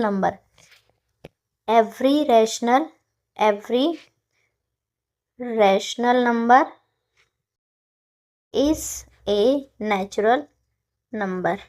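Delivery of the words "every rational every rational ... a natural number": slow dictation. Shown as a demonstration. Teacher to students writing, slow dictation. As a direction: spell it out word by word.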